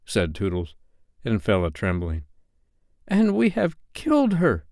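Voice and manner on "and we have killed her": understated horror, pitched high; hoarse voice. A narrator's reading. Nibs realising they killed the lady, mildly hoarse. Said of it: "Nib said hoarsely".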